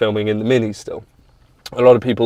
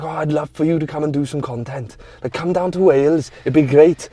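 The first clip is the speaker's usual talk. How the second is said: higher, lilting Welsh impression; Welsh accent; 4 semitones higher than usual. Welsh racer inviting the speaker to Wales, in a Welsh accent. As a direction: imitate a keen Welshman making an invitation. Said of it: "I know he's not that Welsh".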